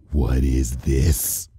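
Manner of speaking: a hushed, dangerous growl of a question; low, dangerous voice. Acted. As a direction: let the threat sit low and slow. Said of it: "in a low, dangerous voice".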